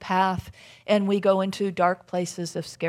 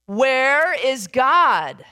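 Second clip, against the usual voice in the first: louder, higher, slow pastor's boom; imposing pastor turning up the volume; 5 semitones higher, 8 dB louder, much slower than usual. Acted. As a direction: raise the booming demand, stretching each word. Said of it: "says a little bit louder".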